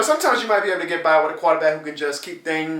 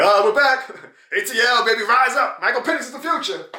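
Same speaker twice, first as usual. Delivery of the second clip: higher, hammed-up hype; mock delusional Falcons fan; 7 semitones higher than usual. Vlogger mocking Falcons fans' hype, higher mock voice. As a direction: gush like a deluded rival fan. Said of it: "be like".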